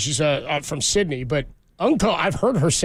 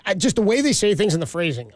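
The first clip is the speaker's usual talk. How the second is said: normal talking voice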